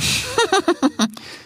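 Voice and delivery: high gleeful villain cackle; villainous laugh. Acted. Aisha gloating over her plan, high cackle. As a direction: cackle like a lioness pleased with herself.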